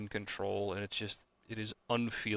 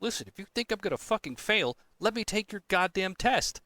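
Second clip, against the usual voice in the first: higher, louder daring retort; much higher, mock-heated challenge to the board; exasperated challenge; 10 semitones higher, 3 dB louder than usual. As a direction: dare them, fed up. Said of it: "I'm like".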